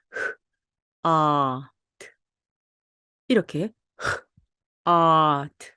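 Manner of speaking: 흐 아 트 split and overdone, breathy 흐; exaggerated hot. Shown as a demonstration. Teacher slightly exaggerating hot for learners. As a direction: break hot into three overdone sounds. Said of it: "약간 과장되게 조금 하고 있습니다".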